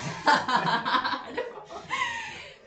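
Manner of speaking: bursting into a high laugh. Real, not acted. Co-host laughing at her partner's remark.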